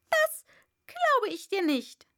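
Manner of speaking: high, loud bird voice, ranting; complaining pigeon. A narrator's reading. Pigeon ranting to Alice, high and loud. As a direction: rattle off the grievances, shrill bird.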